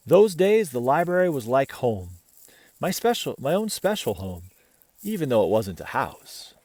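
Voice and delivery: put-on gentle read-aloud voice for children; picture book reading to kids; storybook narration. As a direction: tell it like a bedtime story.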